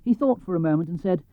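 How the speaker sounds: plain reading voice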